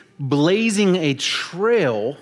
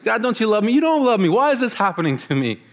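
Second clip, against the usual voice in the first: much higher, whining mock panic over lost keys; hammed-up whine to God, voice up high; mocking a panicked teen who lost his keys; whiny complaint; 8 semitones higher, faster than usual. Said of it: "You're like".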